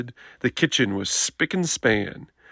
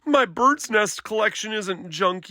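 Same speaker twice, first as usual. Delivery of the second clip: yelling; 8 semitones higher than usual. Acted. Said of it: "yelled Brother at the top of his lungs".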